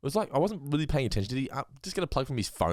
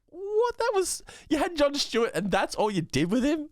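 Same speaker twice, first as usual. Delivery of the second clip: much higher, incredulous outburst; mock disbelief. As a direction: act stunned at the wasted cameo. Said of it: "And I'm thinking".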